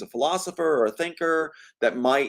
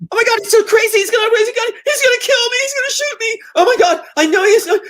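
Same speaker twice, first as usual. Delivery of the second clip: hammed-up hysterical sister, high and loud; sister's accusation; 10 dB louder, faster than usual. Imitating sister making her accusation, hysterical shrieking. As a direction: shriek it in mock panic, far above your own voice. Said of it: "here's what she sounds like".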